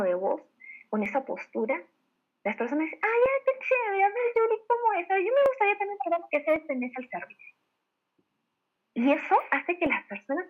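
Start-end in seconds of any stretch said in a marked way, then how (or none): 3.0-7.5 much higher, eager mock-customer voice; acted delight at an offer; excited customer. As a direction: gush with interest, high-pitched. Acting out a won-over, eager customer.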